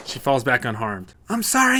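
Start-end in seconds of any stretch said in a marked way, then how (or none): 1.3-1.8 high loud apology; yell. Acted. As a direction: shout sorry over the chaos. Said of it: "yells Letty at Maria".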